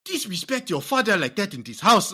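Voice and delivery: put-on loud, high African-accented scolding; mock stern parent in a stock African accent; generic African accent. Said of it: "We all do the same fucking voice".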